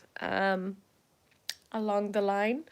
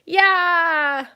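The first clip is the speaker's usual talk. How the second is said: loud, high drawn-out yeah; excited cheer; 7 semitones higher, 7 dB louder than usual. Acted. Team going in together to win, excited cheer.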